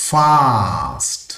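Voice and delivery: ಫಾಸ್ಟ್ with a long, held ಆ; drawn-out vowel. Shown as a demonstration. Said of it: "ಒಂದು vowel sound".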